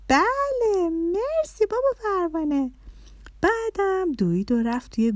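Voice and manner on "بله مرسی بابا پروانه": put-on very high, pleased child voice; little daughter. A narrator's reading. Little girl thanking father for the experiment, high and pleased. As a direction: chirp it like a grateful little girl.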